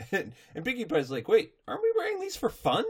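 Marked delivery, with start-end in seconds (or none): 1.3-2.8 high, quick cartoon question; puzzled pony voice. Recapper plays a pony who thought disguises were fun, puzzled. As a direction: squeak it out in innocent confusion.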